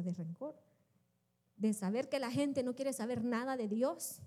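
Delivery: normal talking voice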